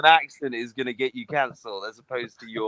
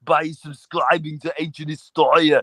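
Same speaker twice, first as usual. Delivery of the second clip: put-on urgent, emphatic Austrian action-hero accent; mock-heroic Austrian strongman; Arnie. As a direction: plead like an action star saving the world. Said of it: "can you do the audio impression?".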